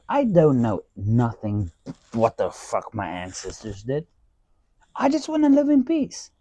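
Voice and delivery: ordinary talk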